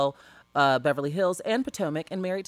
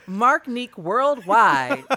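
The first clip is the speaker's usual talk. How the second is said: announcer intro; 4 semitones higher, 6 dB louder, much slower than usual. Acted. Grand slow announcement of guest.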